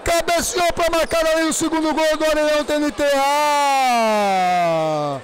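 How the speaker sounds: fast, excited match commentary; play-by-play commentary. Real, not acted. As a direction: call the game live. Live futsal play-by-play.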